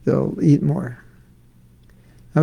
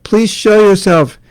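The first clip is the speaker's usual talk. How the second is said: crying out; 6 semitones higher, 5 dB louder than usual. Acted. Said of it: "Radha cries out".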